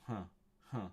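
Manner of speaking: ordinary delivery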